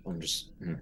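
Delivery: ordinary talk, nothing marked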